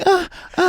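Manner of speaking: high-pitched put-on moans; hammed-up sensual gasps; mock mom moaning. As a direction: moan suggestively to tease. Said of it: "imagine your hot mom going".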